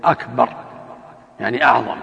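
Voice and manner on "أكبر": "أكبر" short, the ba not stretched; correct akbar. Shown as a demonstration. Sheikh shows the correct word, short. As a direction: say it crisply without dragging. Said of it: "يقول".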